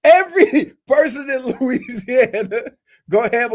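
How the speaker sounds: laughing while talking. Real, not acted. Laughing through the sentence.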